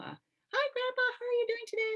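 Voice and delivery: high, bright, loud chirpy hello; high loud greeting. Retold or imitated. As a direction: imitate a loud high-pitched greeting to Grandpa. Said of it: "my daughters sometimes will do this with Grandpa".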